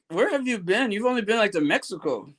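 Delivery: ordinary delivery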